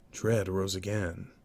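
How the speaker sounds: ordinary delivery, nothing marked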